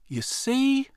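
a tiny sister, bright with joy; lightly voiced happy child; delighted child. A narrator's reading. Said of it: "her voice was filled with delight".

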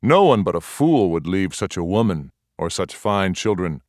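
usual speaking voice

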